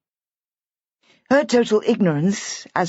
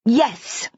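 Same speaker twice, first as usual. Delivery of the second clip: "Yes" pressed firmly and high; emphatic yes; 5 semitones higher than usual. A narrator's reading. Little girl firmly agreeing with Simmy's father. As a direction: lean hard on "Yes". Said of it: "uttered with emphasis".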